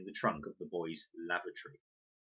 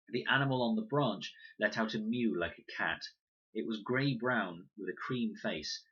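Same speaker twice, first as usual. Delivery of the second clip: usual speaking voice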